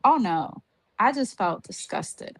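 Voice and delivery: normal talking voice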